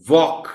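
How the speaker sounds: Walk said clearly, the vowel held as a long aw; Walk with a long open aw sound; pronunciation demo. Shown as a demonstration. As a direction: model Walk loud and clear for a class. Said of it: "اس لفظ کے pronunciation".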